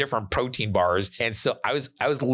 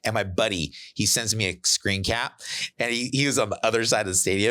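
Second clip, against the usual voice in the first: ordinary delivery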